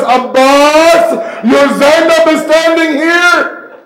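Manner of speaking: high, loud cry out to someone far away; grieving call. Acted. Zainab calling her lost brother, anguished loud cry. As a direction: call out in anguish across the river. Zainab calls to absent Abbas.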